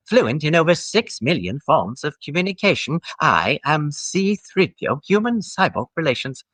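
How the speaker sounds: polite anxious droid announcing himself; C-3PO voice. Shown as a demonstration. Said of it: "with the voice".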